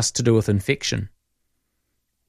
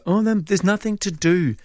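put-on higher childish whine; whining child; 8 semitones higher than usual. Host imitating a child complaining nothing to do, whiny. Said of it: "get off your phones".

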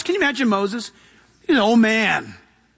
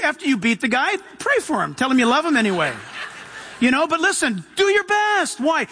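ordinary delivery

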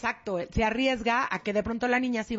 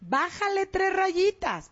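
drawn out, higher and pointed; slow scolding; 6 semitones higher, much slower than usual. Real, not acted. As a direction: tell her to tone it down, slowly. Host scolding bullying parent. (regaño lento y marcado)

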